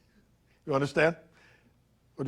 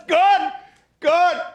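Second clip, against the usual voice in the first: much higher and louder, crowing; loud gloating; 8 dB louder than usual. Acted. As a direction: crow in triumph. Gloating over Fitzgerald.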